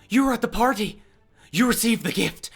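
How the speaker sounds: eager recognition. Acted. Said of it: "as she says".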